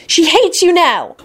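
high, drawn-out indignant squeal; angry squeal. A narrator's reading. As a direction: squeal it slowly, highly offended. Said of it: "Luna squealed angrily".

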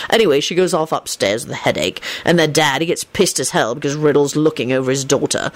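lightly voiced, low storyteller; Lupin recounting gossip. A narrator's reading. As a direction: tell it low and dryly. Lupin continues his story.

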